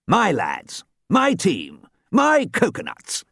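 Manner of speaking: put-on rising proud old coach. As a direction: swell with pride. Archie speaks proudly.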